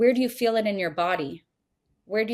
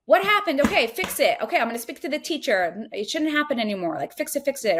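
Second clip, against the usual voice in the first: much higher, louder, brisk fix-it voice; masculine fix-it parent; 6 semitones higher, 3 dB louder than usual. Retold or imitated. Parent reacting to a crying child, in a high, loud, urgent fix-it voice. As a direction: rush to solve the problem out loud. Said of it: "the masculine way is like".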